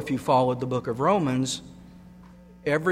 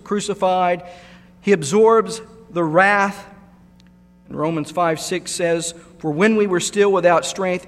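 full-force preaching; 3 semitones higher, 4 dB louder than usual. Acted. Preacher proclaiming the crucifixion to the church, full force. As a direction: proclaim it from the pulpit.

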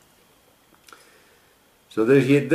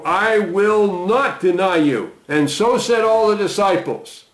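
raised pitch, earnest reading of Peter's vow; scripture reading; 7 semitones higher than usual. Acted. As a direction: lift the voice to read Peter's bold promise. Reading Jesus' warning, Peter's vow.

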